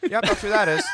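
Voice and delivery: halting director impression, drawn-out names; slow right down on the name, half-laughing; George Lucas. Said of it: "such a good George Lucas impression".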